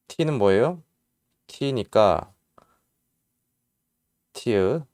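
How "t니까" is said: ordinary talk, nothing marked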